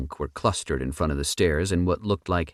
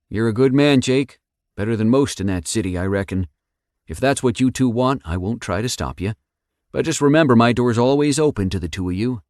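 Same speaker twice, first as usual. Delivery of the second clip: Rick voice; 6 semitones higher, 5 dB louder than usual. A narrator's reading.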